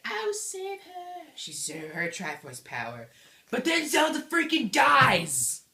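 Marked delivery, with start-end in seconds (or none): 0.1-1.2 very high, slow, airy princess voice; Zelda. Acted. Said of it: "And then Zelda's like". 3.5-5.6 high, loud, drawn-out plot-twist yell; dramatic shout. Acted.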